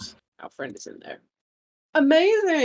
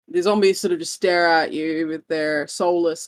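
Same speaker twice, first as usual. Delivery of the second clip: ordinary delivery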